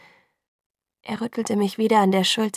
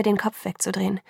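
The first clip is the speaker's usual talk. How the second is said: ordinary delivery, nothing marked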